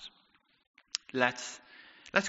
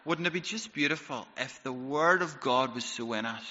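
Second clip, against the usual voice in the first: ordinary talk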